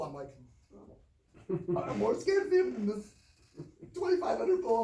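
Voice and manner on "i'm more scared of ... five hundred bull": high loud protest. Retold or imitated. Scared kid protesting.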